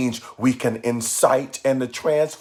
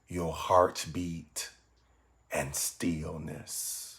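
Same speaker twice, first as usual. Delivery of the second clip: softer, measured meditation voice with long pauses; guided meditation; 3 semitones lower, 7 dB quieter than usual. Acted. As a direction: guide listeners gently inward, phrase by phrase.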